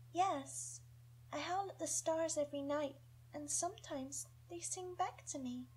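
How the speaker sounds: put-on high, soft dreamy girl's voice; sweet young girl. Young Jala talking of howling at stars, sweet. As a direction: tell it sweetly, like a secret. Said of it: "She has a sweet young voice".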